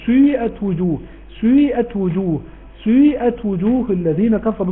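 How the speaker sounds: سيئت with the سين rounded and fronted at once; ishmam on sin. Shown as a demonstration. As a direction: recite سيئت blending u into i on the first letter. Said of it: "ده إشمام".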